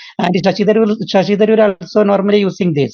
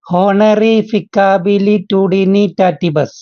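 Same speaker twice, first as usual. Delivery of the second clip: Honorificabilitudinitatibus spoken slowly, each syllable spaced out; much slower than usual. Shown as a demonstration. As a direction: spell the long word out slowly for the class. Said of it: "ഒന്ന് slow ആയിട്ട്". (വാക്ക് സാവധാനം ഓരോ അക്ഷരമായി)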